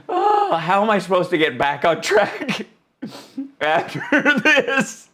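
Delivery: breaking into laughter mid-sentence; laughing hard. Real, not acted. Streamer trying to get back on track, cracking up. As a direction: lose the end of it to real laughter. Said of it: "laughing so hard I'm crying".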